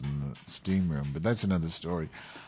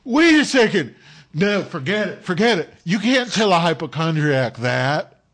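panicked friend; 9 semitones higher, 9 dB louder than usual. Retold or imitated. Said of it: "he goes".

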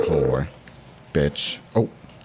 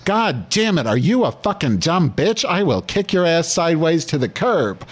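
higher-pitched hammed-up tirade; mock angry rant; 6 semitones higher than usual. Host scolding Vera over the failed upload, hammed-up anger. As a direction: lay into her in playful fury.

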